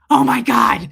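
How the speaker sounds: loud shocked outburst, played up; mock horror. Embarrassed plane passenger seeing the porn scene, mock horror. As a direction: blurt it out, appalled.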